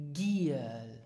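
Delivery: "Giel" drawn out with a hard g; low GHEE-el, "Giel" for repeating; correct pronunciation. Shown as a demonstration. Teacher asking learners to repeat, hard-g "Giel". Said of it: "repeat after me for the correct pronunciation".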